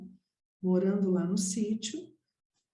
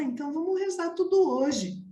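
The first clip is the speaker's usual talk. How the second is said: lightly voiced eager child, higher; retold childish eagerness, pitched up; 7 semitones higher than usual. Six-year-old to her mother about the novena, eagerly insisting.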